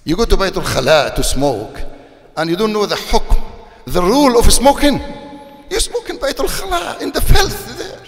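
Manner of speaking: rising to a loud, high shout of reproach; angry rebuke. Retold or imitated. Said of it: "I say".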